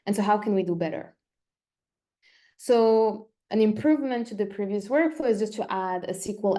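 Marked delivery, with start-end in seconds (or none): none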